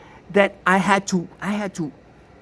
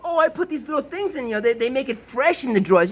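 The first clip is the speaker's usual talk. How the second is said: mock sister; 6 semitones higher than usual. Sister's excuse imitated.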